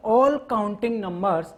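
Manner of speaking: each word drawn out for writing down; very slow dictation. Shown as a demonstration. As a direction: dictate slowly so they can copy. Teacher dictating definition.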